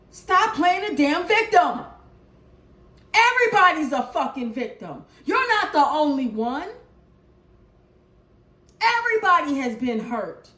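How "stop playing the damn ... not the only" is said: stern scolding. Real, not acted. Scolding viewers.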